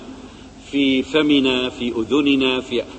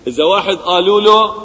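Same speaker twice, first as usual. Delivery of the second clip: usual speaking voice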